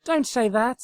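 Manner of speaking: high, loud anguished cry; loud pleading cry; cried out. A narrator's reading. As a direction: cry out in protest. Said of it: "cried Twilight".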